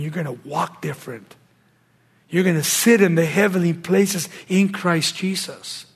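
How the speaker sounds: normal talking voice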